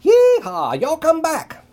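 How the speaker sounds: high, loud yeehaw holler; enthusiastic yell. A narrator's reading. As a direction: holler it out happily. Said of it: "Ray yelled enthusiastically".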